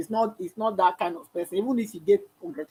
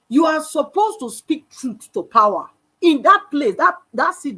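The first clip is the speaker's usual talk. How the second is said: emphatic exhortation; 7 semitones higher, 7 dB louder than usual. Real, not acted. Exhorting leaders.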